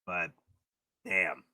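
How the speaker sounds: normal talking voice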